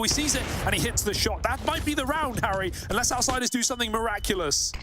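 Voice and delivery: rising hype on the call; esports caster. Real, not acted. Excited play-by-play.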